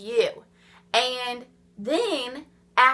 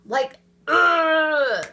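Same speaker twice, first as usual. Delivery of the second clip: louder, fed-up ugh of disgust; angry groan; 4 semitones higher, 4 dB louder than usual. Real, not acted. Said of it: "It just makes me so angry".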